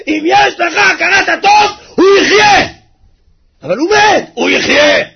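high crying shout; full-force preacher's cry; impassioned preaching. Acted. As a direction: plead it out with a breaking cry.

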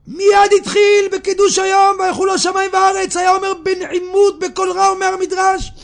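high, sustained declamatory telling. Acted. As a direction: carry it high and ringing over the congregation. Preaches, voice raised and sustained. (דרשה בקול גבוה ונישא)

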